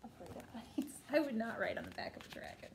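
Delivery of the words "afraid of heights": plain reading voice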